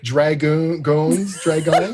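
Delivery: "dragoon" drawn out, stumbling on to "goons" and "dragons"; mock mispronunciation. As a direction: deliberately fumble "dragoon" and "dragons", laughing through it. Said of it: "You're not gonna do a pronunciation guide".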